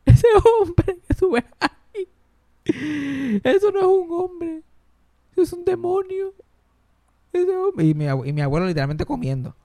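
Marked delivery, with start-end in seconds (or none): 0.1-7.9 very high, slow wailing complaint; abuela llorando. Acted. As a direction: imitate grandma sobbing out her grievance, hammed-up. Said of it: "mira lo que ella llorando". (llanto exagerado de abuela, agudo y lento)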